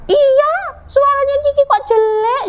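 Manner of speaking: high, teasing agreement; mocking. Duck joins in mocking Ciki, high and teasing. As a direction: pile on in a high taunting voice. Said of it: "Mengejek temannya seperti itu".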